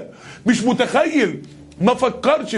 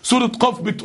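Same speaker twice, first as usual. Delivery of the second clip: usual speaking voice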